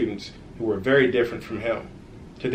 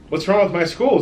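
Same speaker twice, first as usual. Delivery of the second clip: alarmed question; 7 semitones higher, 5 dB louder than usual. Acted. Zach worried asks zombie.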